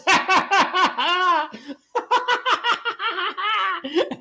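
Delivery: high loud laughing fit; loud cackle. Real, not acted. Streamer laughing at the Cowboys, loud cackle. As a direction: burst out cackling.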